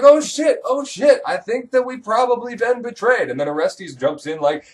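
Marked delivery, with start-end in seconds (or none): 0.0-3.3 much higher, louder, hammed-up panic; mock panic. Queen realizing her lover is dead, in hammed-up panic. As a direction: squeal it like a caught schemer. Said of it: "she's like".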